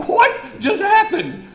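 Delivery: very high, slow, laughing amazement; stunned laughing wonder. Retold or imitated. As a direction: drag it out in delighted disbelief. Amazed at sudden conversions.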